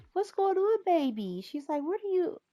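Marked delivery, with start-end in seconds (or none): 0.1-1.4 very high singsong question; cooing baby talk. Acted. Grandma checking on her baby grandson, high coo. 1.8-2.4 high-pitched question to a little one; cooing baby talk. Acted. Grandma finding the baby outside, high coo.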